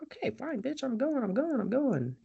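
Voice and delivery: high, quiet whiny grumble; small high voice, giving in testily; mock grudging compliance. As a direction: imitate a squire complaining under his breath. Squire grumbling compliance in retelling.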